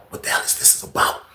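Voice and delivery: soft murmured whisper. Retold or imitated. Inmates staring at Arthur, a hushed whisper. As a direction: whisper like a nosy onlooker.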